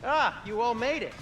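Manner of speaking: high-pitched, excited welcome; delighted greeting. Acted. Host welcoming the group who made it, delighted. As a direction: greet them with a high, happy lift.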